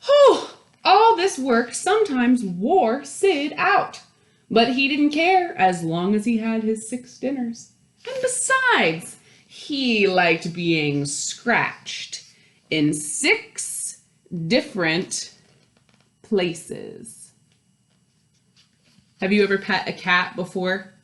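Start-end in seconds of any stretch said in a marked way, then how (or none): 0.0-0.5 loud, high exhausted whew; worn-out whew. Acted. Sid worn out by work. 0.8-17.3 lively storytime read, lingering on the counting; reading to children. A narrator's reading. Reading picture book to children.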